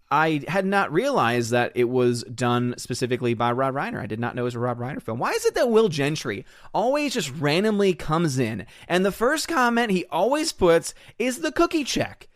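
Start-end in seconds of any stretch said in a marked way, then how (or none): none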